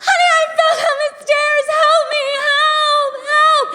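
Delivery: high, fast, shouted wail of distress; high wailing damsel. Retold or imitated. Wife calling to husband after falling, high melodramatic wail. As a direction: cry out like a helpless drama queen. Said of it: "I was like".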